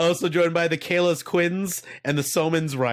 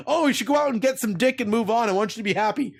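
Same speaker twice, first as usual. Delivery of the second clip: higher, mocking sappy lover voice; mock Gerard Butler; 7 semitones higher than usual. Mocking the movie's hero telling her to move on, sappy higher voice. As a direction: ham up a noble farewell letter. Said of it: "He's like".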